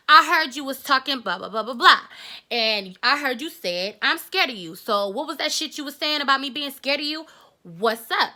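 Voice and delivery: loud, taunting challenge to a fight; imitating the girl picking a fight; confrontational girl. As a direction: call her out, daring her. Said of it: "So she was like".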